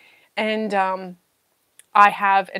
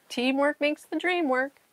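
ordinary talk, nothing marked

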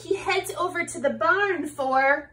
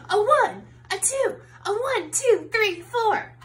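higher rock count-in; 7 semitones higher than usual. Acted. The band counting in the song, higher count-in.

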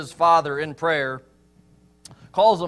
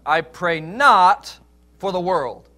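"not" drawn out and louder; slow line, leaning hard on "not"; emphatic NOT; 6 dB louder, slower than usual. Retold or imitated. Preacher quoting Jesus' prayer to the congregation, emphatic "not". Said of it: "emphatic on that".

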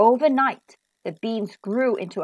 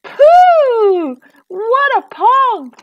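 high, loud, drawn-out cry of disgust; shrieking alarm at a stink; harp's shout; 12 semitones higher, 11 dB louder, much slower than usual. A narrator's reading. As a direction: shout it out high, stretching each word. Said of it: "the harp shouted".

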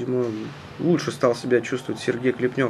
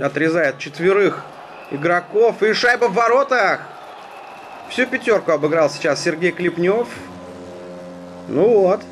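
rising to an excited shout on the goal; excited hockey goal call; 5 semitones higher than usual. Real, not acted. Goal scored, commentator erupts.

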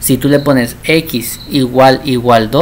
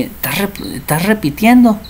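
a high, mock accusing "estás repitiendo" from a parent; lightly voiced nosy parent; mock scolding parent; 7 semitones higher than usual. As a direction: play the nagging relative asking about failing. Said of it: "cuando ustedes reprueben una materia".

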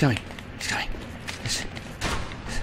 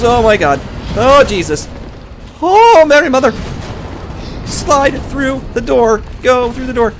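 high, loud panicked yelling; panicking at the boss, high and loud; real panic; 7 dB louder than usual. Real, not acted. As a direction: shriek it in genuine terror. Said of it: "freaking out".

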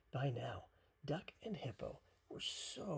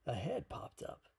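usual speaking voice

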